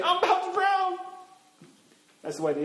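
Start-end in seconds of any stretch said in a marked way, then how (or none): 0.0-1.0 high, mock-panic wail; panicked cry. Believer losing faith the next minute, mock panic. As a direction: cry out as if sinking. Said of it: "the next minute we're saying".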